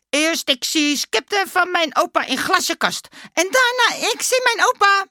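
high, loud voice building to a peak; excited Dummy. A narrator's reading. Dummy revealing he saw his grandpa, excited and rising. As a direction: build up to a triumphant high finish.

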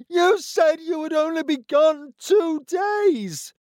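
high, slow furious shout at her husband; a wife shouting at her returned husband; angry yell. A narrator's reading. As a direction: yell it, hurt and accusing. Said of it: "she yelled at him".

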